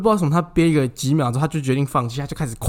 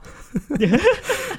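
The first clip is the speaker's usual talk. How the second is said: laughing. Real, not acted. Coworker bursts out laughing.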